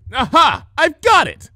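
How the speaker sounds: loud, high burst of inspiration; loud sudden exclamation. A narrator's reading. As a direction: blurt it out, struck by an idea. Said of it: "she exclaimed, loud and abrupt".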